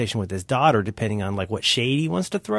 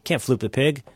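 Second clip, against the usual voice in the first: usual speaking voice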